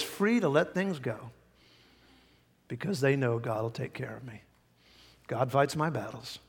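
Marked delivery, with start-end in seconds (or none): none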